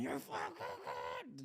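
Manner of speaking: high, slow screamed curse; high slow pained scream; retold scream. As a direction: scream it out in pain. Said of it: "Just, just screaming at him".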